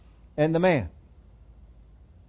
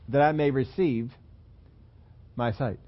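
"receive" held up, then a pause and a lower "my sight"; stress on receive; 2 semitones lower than usual. Shown as a demonstration. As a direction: demonstrate the weight on "receive", letting "my sight" fall. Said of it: "He puts his emphasis on the receiving".

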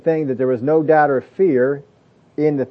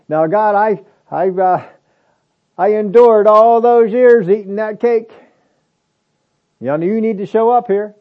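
higher and louder, slowed griping at God; mock complaining prayer; 7 semitones higher, 6 dB louder than usual. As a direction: play a self-pitying complainer. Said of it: "he could have been complaining about that".

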